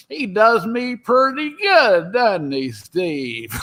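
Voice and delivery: imitating an older comic actor, slow and high; Don Knotts. Older actor praising his impressionist backstage, slow elderly impression. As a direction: start high and drawn out, sink at the end. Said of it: "he's older, he goes".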